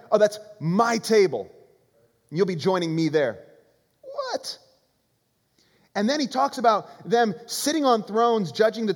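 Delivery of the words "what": squeaky high-pitched disbelief; incredulous what. Retold or imitated. Preacher mocks the disciples' shock, high and incredulous. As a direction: jump way up in shock. Shocked reaction to Jesus' claim.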